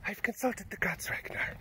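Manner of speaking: soft, high, breathy mystic whisper; hushed and eerie, like a seer who speaks for the gods; Floki impression. Whispering to Ragnar as a devoted seer. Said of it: "It's my Floki impression".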